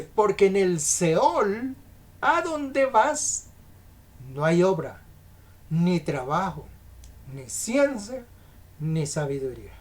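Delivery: slow proclamation with pauses between items; emphatic scripture reading. Acted. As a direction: toll out each item of the verse. Reads scripture aloud to congregation.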